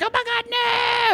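high, slow mock scream of horror; panicked scream. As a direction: shriek it as if watching someone get shot. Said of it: "Sarah's like".

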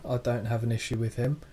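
normal talking voice

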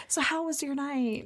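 soft, slow coy question. Retold or imitated. Chelsea fishing about his night, coy and soft. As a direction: ask it softly, drawn out and knowing.

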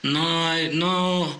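mock-German polite refusal; halting German-accented objection. German who just kinda points it out, mock German accent. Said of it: "I've met Germans".

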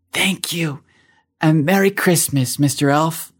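put-on whispering little girl. A narrator's reading. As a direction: whisper it sweetly in his ear. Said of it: "she whispered in his ear".

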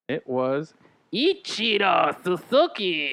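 slow, exaggerated Japanese accent; over-the-top Japanese accent. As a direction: draw out the name in a hammy Japanese accent. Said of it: "over-the-top Japanese voice there".

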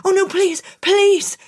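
high, desperate begging; pleading cry. Acted. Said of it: "cried the piper".

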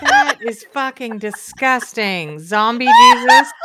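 disgusted, laughing shout. Real, not acted. Disgusted at the meme.

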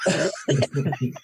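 high, drawn-out words squeezed through laughter; real cracking up, barely getting the words out; helpless laughter. Real, not acted. Cracking up at the impression.

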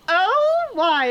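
high, bright "Oh, why?" in mock surprise; surprised oh. Asking her date why, in mock surprise. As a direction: squeak it out, curious. Teller acting her own reply.

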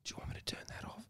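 quick unvoiced mock whisper; fast whisper. Friend mocks the creepy host by whispering fast. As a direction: rattle it off in a hushed whisper.

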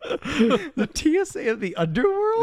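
high, slow, laughing question; laughing disbelief. Real, not acted. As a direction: ask it while cracking up.

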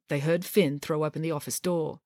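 usual speaking voice

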